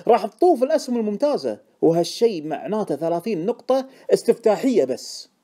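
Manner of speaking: high, loud, emphatic sales push; worked-up insistence. A narrator's reading. Informant assuring Deacon the tip is right, excited insistence.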